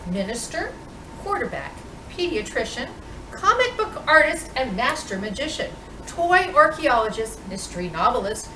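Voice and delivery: normal talking voice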